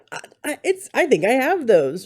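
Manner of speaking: halting, high-pitched blurt, acted out; retold outburst. Retelling how she burst out during an interview, blurting. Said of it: "I burst out and said".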